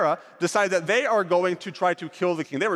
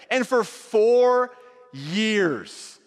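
drawn-out for four; 4 semitones higher, much slower than usual. A narrator's reading. Dramatic pause in a retold story.

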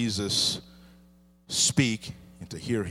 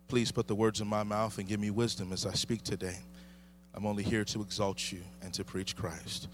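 prayer; 2 semitones lower, 4 dB quieter than usual. Real, not acted. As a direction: pray quietly before preaching. Opening prayer before the sermon.

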